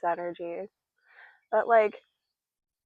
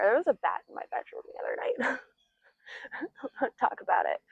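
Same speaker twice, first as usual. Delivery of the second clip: whisper. Real, not acted. Speaker confides the bat story, whispered. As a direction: whisper it like a secret. Hushed confession about the bat.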